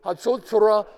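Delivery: Hatzotzra blared loud and high like a trumpet; trumpet call. Shown as a demonstration. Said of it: "name of the instrument sounds like a trumpet".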